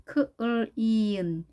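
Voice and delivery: "크을 이은" slowly split into k, l, long ee, n; sound-by-sound demo. Shown as a demonstration. Teacher building clean for learners, slow sound-by-sound demo of "크을 이은". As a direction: spell out the sounds of "크을 이은" slowly, one by one. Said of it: "이중모음입니다".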